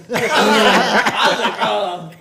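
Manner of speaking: laughing through the words, loud; laughing through the hospital story. Real, not acted. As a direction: crack up while telling it. Laughing while retelling.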